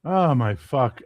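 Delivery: ordinary talk, nothing marked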